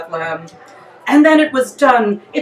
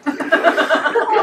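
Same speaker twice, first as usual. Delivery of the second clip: a real high giggle rising; laughing; 6 semitones higher than usual. Real, not acted. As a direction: crack up at the prayer. Narrator laughs at own story.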